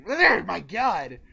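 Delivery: loud, slow laughing groan; laughing exasperation. Real, not acted. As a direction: laugh at your own flub. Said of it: "words get me tongue twisted".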